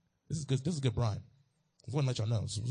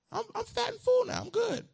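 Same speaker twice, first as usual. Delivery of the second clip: satisfied squeal. Retold or imitated. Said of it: "So you say".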